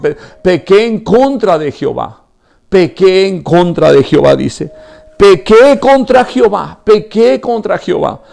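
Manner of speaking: high, loud, impassioned sermon; preaching at full force. Acted. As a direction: pour it all out to the congregation. (predicación a todo pulmón, aguda y fuerte)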